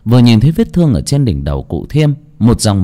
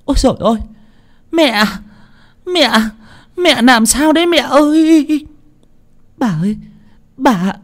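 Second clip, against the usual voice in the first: very high, shrieking cries; panicked screams. A narrator's reading. Wife and daughter screaming from the gate, panicked. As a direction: scream out in alarm, pitched way up. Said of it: "vang lên những tiếng hét thất thanh".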